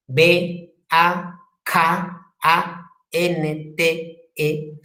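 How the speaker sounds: "B A K A N T E" as separate letter-sounds, very slow; teacher slowly spells "B A K A N T E" sound by sound; spelling out phonemes. Shown as a demonstration. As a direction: sound out "B A K A N T E" one phoneme at a time. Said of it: "Los fonemas se representan entre barras".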